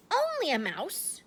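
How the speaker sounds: a small queen insulted; outraged squeaky cry; indignant cry. Acted. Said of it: "cried the little animal indignantly".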